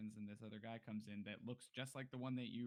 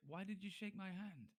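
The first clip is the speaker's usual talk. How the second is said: baffled; 9 semitones higher than usual. Acted. Guard bewildered after handshake.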